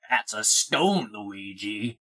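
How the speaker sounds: low, slow Mario voice. Pretend. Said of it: "This is my Mario voice".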